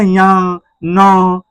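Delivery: या and न drawn out through the nose; nasal sound demo. Shown as a demonstration. Teacher demonstrating nasal letters, long nasal या, न. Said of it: "नासिका से बोला जाता है".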